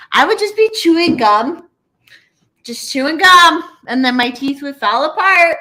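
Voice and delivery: worked-up griping about chewing gum; exasperated rant. Real, not acted. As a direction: vent about it with disbelief.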